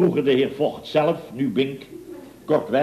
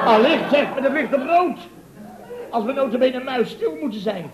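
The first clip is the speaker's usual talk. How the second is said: indignant boss; 10 semitones higher than usual. Acted. Boss justifying silencing the announcer, high indignant bluster. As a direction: bluster self-righteously.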